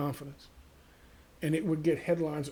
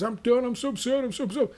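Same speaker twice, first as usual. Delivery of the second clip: mocking whiny streamer; 8 semitones higher, faster than usual. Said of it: "He says".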